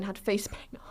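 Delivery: hushed disbelief; incredulous whisper. Real, not acted. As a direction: whisper the detail like a scandal. Whispered disbelief about face paint.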